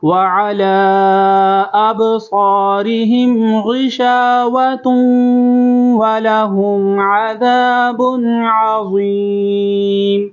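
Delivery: slow, high melodic recitation of ختم اللہ علی قلوبہم; model recitation. Shown as a demonstration. Teacher recites so students can listen carefully, slow melodic chant. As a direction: chant it slowly for students to copy.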